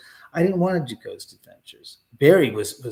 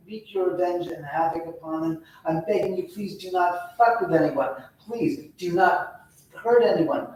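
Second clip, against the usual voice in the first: higher-pitched, earnest begging; pleading; 4 semitones higher than usual. Retold or imitated. Investigator begging spirits not to hurt anyone, pleading. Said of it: "That was me basically pleading".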